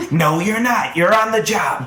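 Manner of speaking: acted angry telling-off; exasperated scolding. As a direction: snap back loudly at a slacking employee. Pao scolds the gambling employee.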